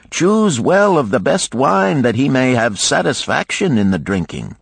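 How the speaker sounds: lightly voiced host giving grand orders; hospitable gold lender. Lender orders slave to serve his honored guest, lightly commanding. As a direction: play the expansive host, with a touch of command.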